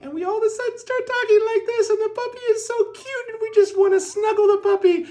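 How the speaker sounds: put-on very high, loud excited baby-talk squeal; high-pitched puppy talk. Shown as a demonstration. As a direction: gush over a cute puppy in a squeaky, sky-high voice. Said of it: "the pitch of our voice goes up".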